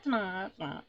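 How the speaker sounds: drawn-out cawing imitation; magpie-like accent. Trying the accent as magpie, drawn-out caw. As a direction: wail the vowels like a magpie. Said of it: "gotta sound like a magpie".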